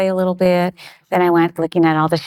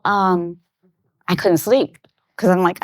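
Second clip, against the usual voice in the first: ordinary talk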